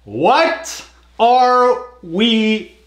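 drawn-out disbelief. Real, not acted. Echoing the comedian's line in disbelief.

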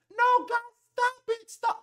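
high, loud panicked plea to stop a fight; pleading to stop. Retold or imitated. Breaking up a fight between two grown men, high panicked plea. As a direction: beg them to break it up, voice jumping high. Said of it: "try to be like".

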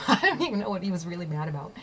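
quick, amused laugh through the words. Real, not acted. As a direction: rush it out through a real laugh. Laughs at her own fake apology.

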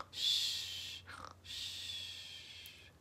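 very soft breathy shh; hushed, voiceless pretend-sleep breathing; pretend sleep shushing. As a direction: shush as if everyone is asleep.

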